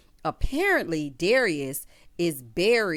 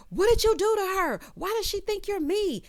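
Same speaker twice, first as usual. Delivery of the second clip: much higher, upset demand; alarmed questions; 12 semitones higher than usual. Acted. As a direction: ask in sudden alarm. Leah panics about her mother.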